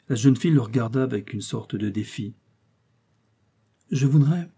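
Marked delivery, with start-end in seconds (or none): 3.9-4.5 higher, mildly forceful; more forceful. A narrator's reading. Young princess answering defiantly about her ideal, with force. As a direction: put some force into it. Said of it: "dit-elle avec plus de force".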